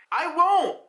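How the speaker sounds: high, indignant acted refusal; pissed-off refusal. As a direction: refuse sharply, pitch way up. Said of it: "he's just pissed off".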